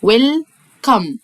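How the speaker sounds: ويلكم split slowly into wel-kam; slow syllable split. Shown as a demonstration. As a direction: break the word into syllables for kids. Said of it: "بنعلمك قسم الكلمات الكبيرة".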